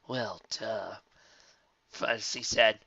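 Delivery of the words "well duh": soft dismissive drawl; eye-rolling. Acted. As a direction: roll your eyes at it. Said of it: "rolling his eyes".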